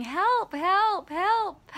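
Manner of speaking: high, acted cries for help; mock yell for help. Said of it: "and she's yelling".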